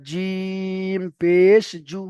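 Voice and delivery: জিম held very long, then stretched পেশ জু; drawn-out recitation. Shown as a demonstration. Teacher shows the required three-alif stretch, very drawn out. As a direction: chant জিম পেশ জু slowly with long vowels. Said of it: "এই ঢঙে পড়তে হবে".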